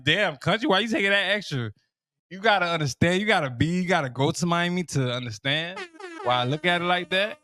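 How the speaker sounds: ordinary talk, nothing marked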